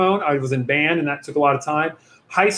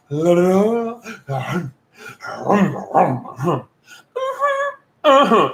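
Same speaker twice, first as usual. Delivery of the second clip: imitated Ace Ventura noise; 3 semitones higher, slower than usual. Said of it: "and goes".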